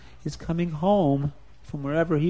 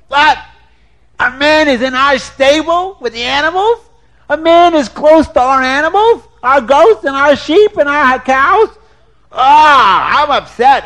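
louder, mounting outrage; upset husband; 8 semitones higher, 6 dB louder than usual. Acted. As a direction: work yourself up into a fuss. Husband Schwarzer Wolf.

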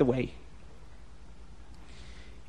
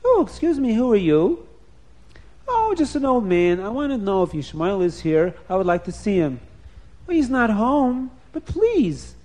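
second wife voice; 2 semitones higher, 4 dB louder than usual. Acted. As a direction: answer the caller kindly. Kind second wife welcoming.

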